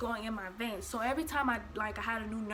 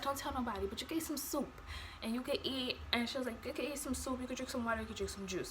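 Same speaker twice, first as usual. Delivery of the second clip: hushed; 8 dB quieter, faster than usual. Retold or imitated. Nurse's secret retold softly.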